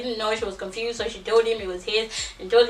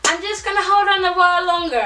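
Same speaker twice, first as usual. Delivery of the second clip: high, lightly voiced naive girlfriend; a hint of her hopeful younger self; mock naive self; 8 semitones higher than usual. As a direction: play her past self as high and gullible. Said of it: "You're like".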